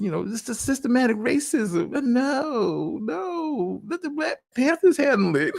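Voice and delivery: mocking the wife. Voicing the wife's reply.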